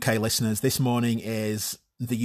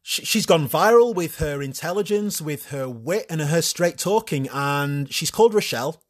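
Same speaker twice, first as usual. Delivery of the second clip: TV presenter Phil; 4 semitones higher than usual. Pretend. Talk-show host introducing his young guest, upbeat TV voice. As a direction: present her like a chirpy morning show host. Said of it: "Phil coming out of a commercial break and this happening".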